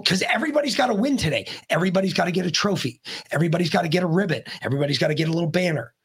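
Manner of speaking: fast, mocking run-through; rapid scornful listing; sarcastic rattling list. Real, not acted. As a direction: reel it off like it's a joke. Mocking rant about trophies.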